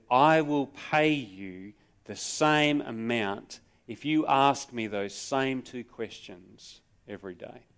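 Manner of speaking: normal talking voice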